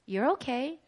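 soothing mother. Retold or imitated. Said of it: "And she'd be like".

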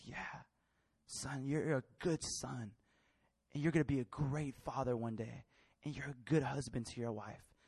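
low, quiet, sincere dad voice; soft, measured reassurance; tender fatherly affirmation. Retold or imitated. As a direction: affirm a son gently, with weight. Father grants the longed-for affirmation.

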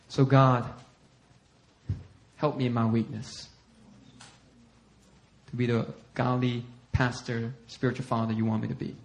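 low, soft, halting prayer; earnest prayer. Real, not acted. Pastor asking God for help in weakness, humble prayer. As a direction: ask humbly, pausing between phrases. Prays aloud.

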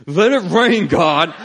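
high, loud cry to God; desperate plea. Retold or imitated. Preacher getting desperate as the congregation repeats, desperate cry. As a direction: belt out a desperate prayer. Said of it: "like, getting desperate".

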